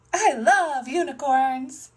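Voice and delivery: high, loud, drawn-out delight; thrilled child. Acted. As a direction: gush slowly like a delighted child. Voices Peppa thrilled with unicorn floaty.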